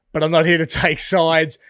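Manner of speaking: exasperated laughing repeat of "I'm not here to take sides". Real, not acted.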